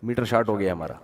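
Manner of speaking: بہت نیچی آواز میں ضدی سبزی والا, ٹالتے ہوئے; جاؤ بس، نیچی دبی آواز میں; stubborn fed-up seller. Retold or imitated. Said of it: "اس نے کہا". (دکاندار غصے میں گاہک کو نیچی آواز میں ٹالتا ہے)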